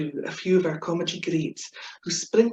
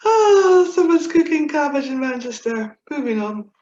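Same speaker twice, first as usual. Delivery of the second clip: "Someone's cooking cabbage in Manchester" higher and louder, crying with laughter; laughing through it; 8 semitones higher, 7 dB louder than usual. Real, not acted. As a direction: repeat the punchline while cracking up. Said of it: "convulsing into fits of laughter".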